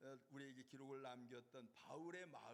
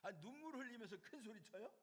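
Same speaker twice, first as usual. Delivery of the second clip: incredulous; 8 semitones higher, 4 dB louder, faster than usual. Real, not acted. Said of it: "incredulous question".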